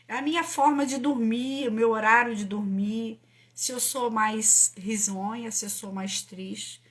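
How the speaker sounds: ordinary delivery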